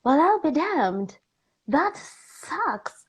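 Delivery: high, drawn-out dismay; slow, swooping disbelief; dismayed realization. Retold or imitated. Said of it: "I was just like".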